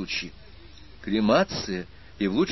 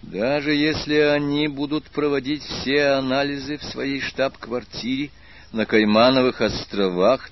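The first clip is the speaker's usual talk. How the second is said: plain reading voice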